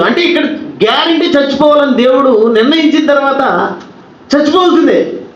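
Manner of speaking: high, driving sermon voice; fervent preaching. Acted. Preacher urging the congregation about death, fervent and high.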